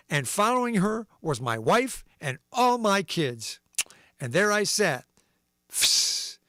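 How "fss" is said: a short unvoiced hiss as punctuation; whispered fss for a dash. Shown as a demonstration. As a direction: slip the dash hiss into the punchline. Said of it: "a dash would be a fss".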